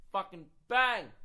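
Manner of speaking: dramatic crash yell, slow on the last word; explosive shout. Retold or imitated. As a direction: burst out with the sudden impact. Crash acted out a second time.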